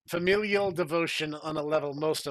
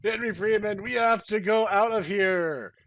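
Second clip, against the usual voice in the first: higher, louder urgent plea; urgent warning; 6 semitones higher, 7 dB louder than usual. Acted. A guy warns Henry Freeman to flee, high and urgent. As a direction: urge him to flee now. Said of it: "a guy said to Henry Freeman".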